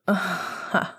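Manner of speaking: short breath of laughter; soft laugh. A narrator's reading. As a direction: laugh softly to yourself. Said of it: "Sirius laughed".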